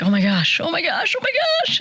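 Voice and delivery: rising panic as he keeps getting flipped; mounting panic. Retold or imitated. Mom watching her son's match, climbing panicked exclamations. Watching son nearly get pinned.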